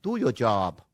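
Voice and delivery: a weak try at Boston accent; attempting a Boston accent. Shown as a demonstration. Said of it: "Boston accent real quick".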